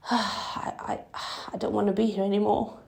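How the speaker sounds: tearful, voice catching after a sigh; fighting back real tears while speaking. Real, not acted. Breaks down telling story, pauses.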